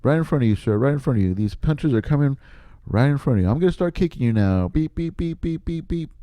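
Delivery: high mock beeps and taunts; hammed-up robot beeps; mock beeping fighter. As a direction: taunt a blind man with beeping. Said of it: "I gotta make sounds".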